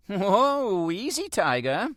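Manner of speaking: put-on high, teasing older woman; older neighbour steadying him from behind; old woman's voice.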